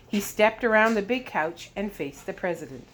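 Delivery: usual speaking voice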